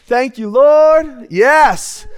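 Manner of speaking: loud, high mock praise; rapturous thanks. Diner tasting Chick-fil-A, rapturous thanks. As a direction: shout thanks like a revival amen.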